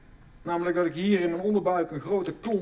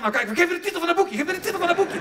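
higher, louder eager plea; lightly voiced men begging for the booklet; eager men in audience; 10 semitones higher, 5 dB louder, faster than usual. Men in the audience begging for the title, higher and louder. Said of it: "Ik zie die mannen".

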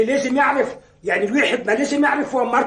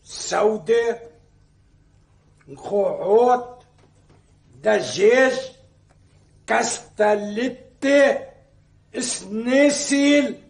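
slow, savoured item by item; drawn-out food listing; much slower than usual. Acted. Husband listing breakfast dishes he craves, slowly relishing each. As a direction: stretch each dish out with pauses between.

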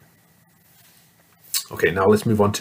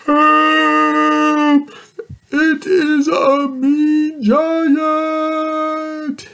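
much higher, loud panicked cry, slow; frightened giant yell; 7 dB louder, much slower than usual. Acted. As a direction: scream for help as a scared giant. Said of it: "yelled the mean giant".

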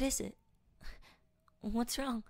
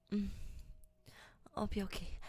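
usual speaking voice

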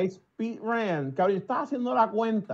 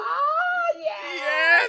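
much higher cracking cry of delight; ecstatic yell. Retold or imitated. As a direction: scream in triumph. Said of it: "Y yo". (grito de euforia)

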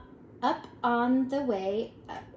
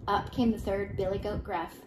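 ordinary delivery

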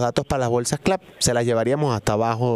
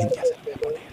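robot voice; 5 dB quieter, faster than usual. Pretend. Fake answering machine voice.

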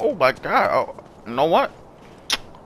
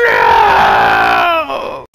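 low, loud hammed-up howl of no; drawn-out mock despair shout; Skywalker's anguished no; 7 dB louder than usual. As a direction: wail it like Luke learning the truth. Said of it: "and I was fucking Skywalker".

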